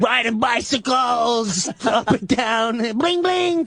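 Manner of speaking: high, drawn-out mock glee; mock-carefree singsong. Neighbor to friend, mocking the once happy street, high singsong. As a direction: play up the happy bike ride, high and slow.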